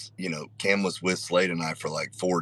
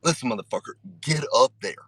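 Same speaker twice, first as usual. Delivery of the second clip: put-on anger, pitched up; mock-pissed order; 5 semitones higher, faster than usual. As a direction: act pissed to get someone moving. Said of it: "I'm not actually pissed".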